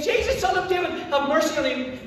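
high-pitched urgent call; shouting again. Acted. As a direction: call out desperately to a man walking past. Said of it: "he shouts again".

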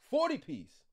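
usual speaking voice

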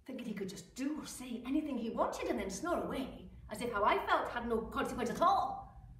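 ordinary talk, nothing marked

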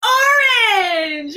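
high excited shout; excited reveal. Acted. Teacher shows kids her orange wig, excited shout. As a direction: burst out with the reveal.

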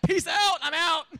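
loud, high cocky shout; gleeful sign-off. Acted. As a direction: yell a breezy goodbye to the doubters. Noah gleefully leaves the mockers.

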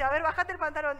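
doctor's order. Retold or imitated. Doctor ordering the patient in front of everyone, loud. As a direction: order her to drop her trousers.

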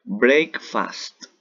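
"Breakfast" said again, firm and clear in English; repeat model word. Shown as a demonstration. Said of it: "Otra vez". ("Breakfast" otra vez, claro y firme)

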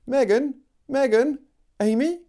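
high calls across the field; calling out. Acted. Amy calling out to Megan.

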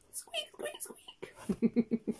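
very high little squeaks; mouse squeaking. Acted. Teacher counting children in to squeak like mice, high tiny squeaks. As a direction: squeak like a family of baby mice. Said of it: "more than one mouse squeaking".

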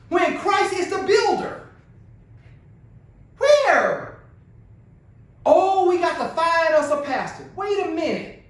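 high, insistent preacher's challenge; impassioned preaching. Real, not acted. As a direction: demand it of the congregation, pitch climbing. Preaches at full force.

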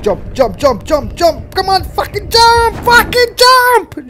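rising from loud to a high screaming plea, slowing at the end; panicked yelling. Real, not acted. Player desperate to escape the monster, building panicked shout. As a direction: scream at your character to jump. Frantic button-mashing escape.

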